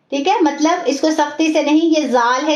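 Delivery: normal talking voice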